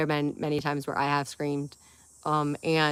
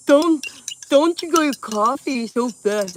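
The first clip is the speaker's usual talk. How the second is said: higher, louder mock of the ex, slow and nagging; 8 semitones higher, 10 dB louder, slower than usual. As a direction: mimic a nagging ex-boyfriend, drawn out. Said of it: "do it sound exactly like him".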